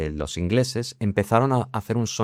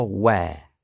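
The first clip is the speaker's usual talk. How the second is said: British where. Shown as a demonstration. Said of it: "En británico".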